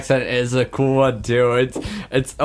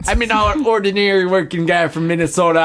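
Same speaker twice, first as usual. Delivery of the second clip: put-on higher Minnesota accent, folksy campaign pitch; Minnesota politician; 4 semitones higher than usual. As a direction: join in as the candidate in a Minnesota accent. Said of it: "That's pretty good".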